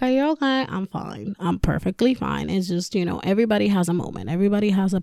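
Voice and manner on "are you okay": higher-pitched imitation of worried texters; mock concern. Mimicking worried people texting her.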